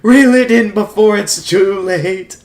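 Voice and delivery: quoting a cartoon sailor in alarm; frantic sailor voice. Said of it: "I'm glad you remembered that line".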